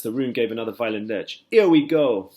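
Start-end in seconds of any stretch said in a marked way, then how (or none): 1.5-2.3 loud excited shout; excited cry. A narrator's reading. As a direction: yell as the room lurches. Insects cheering as peach lurches.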